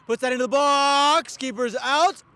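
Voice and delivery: rising, loud, stretched call; excited commentary. Real, not acted. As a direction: build the tension on the cross. Excited cross into the box.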